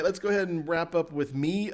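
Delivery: normal talking voice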